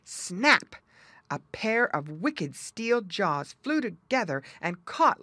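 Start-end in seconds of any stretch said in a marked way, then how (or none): none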